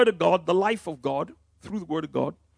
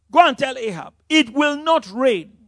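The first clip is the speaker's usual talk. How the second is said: prophet's stern decree; 7 semitones higher, 5 dB louder than usual. Retold or imitated. Preacher voices Elijah's decree.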